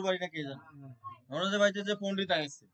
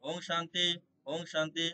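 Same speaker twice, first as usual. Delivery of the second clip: louder prayer, word by word with pauses; loud devotional prayer; 6 dB louder, slower than usual. Acted. Devotee praying aloud to Lord Krishna for his family, fervent loud invocation. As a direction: call out each plea to the Lord with fervour.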